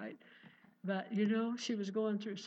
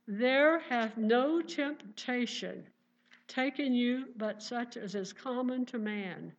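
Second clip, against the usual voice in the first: louder, measured Bible reading; scripture reading; 5 dB louder than usual. Acted. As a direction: read the verse out with weight to the congregation. Reads scripture aloud from pulpit.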